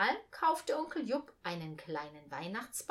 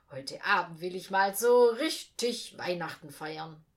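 lightly cheerful, louder; 3 semitones lower, 7 dB louder than usual. A narrator's reading. Uncle Jupp tells the boy his plan, cheerfully. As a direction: sound pleased with the plan. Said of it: "sagte er vergnügt".

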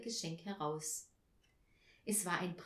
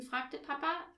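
usual speaking voice

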